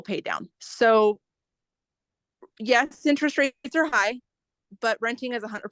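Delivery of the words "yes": ordinary talk, nothing marked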